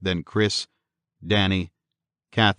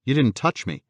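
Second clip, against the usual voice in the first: higher-pitched wifely protest; 6 semitones higher than usual. A narrator's reading. Wife reassures her husband she is unhurt, protesting. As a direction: insist on it, lightly protesting. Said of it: "she protested".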